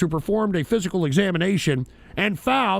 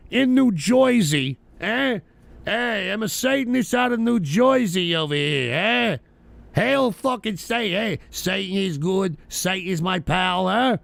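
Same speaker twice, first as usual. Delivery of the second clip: hammed-up New Jersey wise guy; mock New Jersey accent. Host imitating a Satanist from New Jersey, mock Jersey accent.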